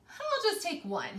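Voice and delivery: high little mouse voice. Acted. Teacher voicing the mouse for her class, high and sly. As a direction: squeak it like a tempted mouse. Mouse's greedy thought in story.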